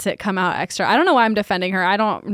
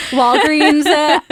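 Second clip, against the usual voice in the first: laughing out the name; 6 semitones higher, 5 dB louder, slower than usual. Real, not acted. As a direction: laugh the brand name with scorn. Mocking the pharmacy chain.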